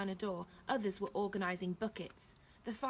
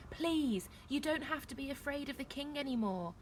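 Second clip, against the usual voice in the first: higher, earnest appeal; pleading; 6 semitones higher than usual. A narrator's reading. Neil to the frightened woman, pleading. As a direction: plead and reassure.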